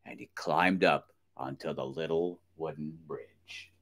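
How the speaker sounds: ordinary delivery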